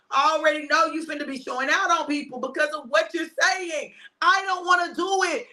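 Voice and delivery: high-pitched reluctant protest. Retold or imitated. Arguing with God about a task, high-pitched protest. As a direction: plead your objection, pitched well up.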